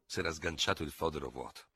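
usual speaking voice

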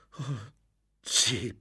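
high, furious exclamation; beside himself. A narrator's reading. Lorenzo vowing to make him pay, beside himself. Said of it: "esclamò Lorenzo fuori di sé".

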